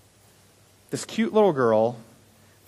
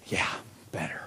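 put-on much softer small-child yeah, better; whisper-soft like a crying toddler; tiny tearful reply; 10 semitones lower, 14 dB quieter than usual. Maddie in tears tells the pastor she's better, much softer.

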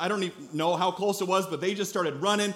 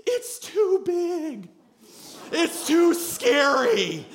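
put-on high, slow whimpering child; scared kid voice; 11 semitones higher, 5 dB louder, much slower than usual. Kids at the water's edge refusing to go in, scared. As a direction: quaver like a frightened little kid. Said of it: "shivering, going".

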